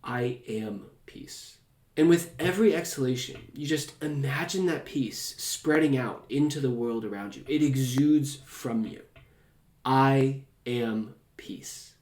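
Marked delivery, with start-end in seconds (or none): 0.0-1.6 slow, soft, low mantra; calm inner mantra. Shown as a demonstration. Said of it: "is simply to think". 9.9-11.9 very slow, each word drawn out; slow mantra. Shown as a demonstration. Demonstrates a breathing meditation mantra.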